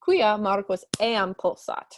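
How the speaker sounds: ordinary delivery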